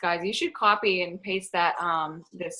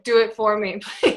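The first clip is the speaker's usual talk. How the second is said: laughing plea; 3 semitones higher, 6 dB louder than usual. Pretend. Playful plea to coworker.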